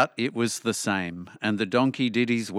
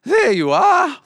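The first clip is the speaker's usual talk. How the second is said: high, loud donkey approval; approving donkey; 8 dB louder than usual. A narrator's reading. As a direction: pipe up loudly, pleased with the singer. Donkey applauds the cat's yowling.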